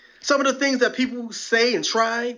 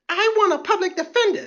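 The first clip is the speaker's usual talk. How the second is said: high, mocking arrestee voice; shrill arrestee; 11 semitones higher than usual. Comic mimics an arrestee demanding a lawyer, high mocking voice. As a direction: squeal for a lawyer like a spoiled detainee. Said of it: "call their bluff".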